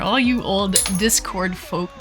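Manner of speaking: usual speaking voice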